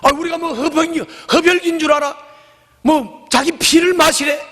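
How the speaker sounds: high, mocking disbelief; outraged crowd. Scandalized crowd mocking Jesus's words, high and outraged. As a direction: ridicule the idea of eating his flesh. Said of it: "막 그러면서 막 수근거리고 난리 났어요".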